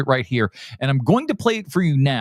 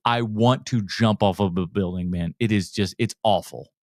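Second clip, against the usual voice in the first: usual speaking voice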